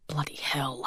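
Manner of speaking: whispered, soft frustrated curse; groaned curse. A narrator's reading. Robbie interrupted by a knock at the door, whispered groaned curse. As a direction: breathe it out under the breath, annoyed. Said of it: "Robbie groaned".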